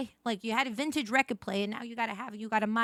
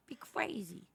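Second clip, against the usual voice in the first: whispering; 8 dB quieter, slower than usual. Pretend. Repeats phrase in new ways.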